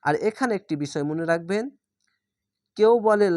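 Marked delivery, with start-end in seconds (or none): none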